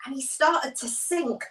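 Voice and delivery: ordinary talk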